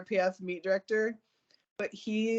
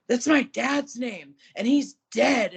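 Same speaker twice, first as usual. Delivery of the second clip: slow, louder, higher pointed retort; indignant retort; 5 semitones higher, 6 dB louder, slower than usual. Retold or imitated. Telling the meet official off, slow and pointed. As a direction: lay it on him slowly and pointedly. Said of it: "And I was like".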